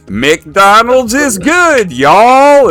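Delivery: high, slow, loud mock Texan. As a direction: stretch it out like a caricature Texan. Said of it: "stereotypical Texas".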